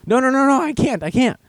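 high, rapid-fire comic protest; mock panicked refusal. Non-swimmer told to rescue the kid, mock panic. Acts parent refusing to jump in.

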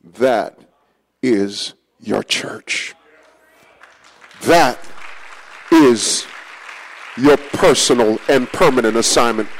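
low, slow, soft divine voice; low quiet voice of the Lord; the Lord speaking gently. Retold or imitated. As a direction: deliver it slowly like a tender revelation. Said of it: "he pointed at them and said".

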